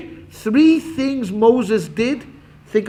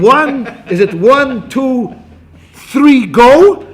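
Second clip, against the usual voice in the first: slow count then a loud "go" after three; 5 semitones higher, 7 dB louder, much slower than usual. Shown as a demonstration. Teacher demonstrating a race start, GO shouted last. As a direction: build the count and shout GO at the end. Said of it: "Or when you say go on three".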